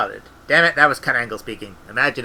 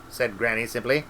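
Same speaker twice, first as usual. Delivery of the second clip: usual speaking voice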